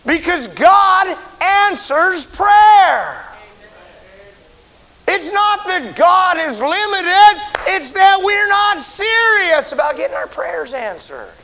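high, loud, slow shouted preaching; full-force preaching. Real, not acted. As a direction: thunder it out from the pulpit.

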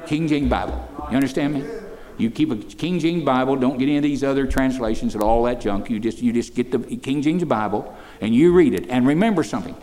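loud, ringing pulpit proclamation; preaching at full force. Acted. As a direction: preach it out to the room. Exhorts youth about the Bible.

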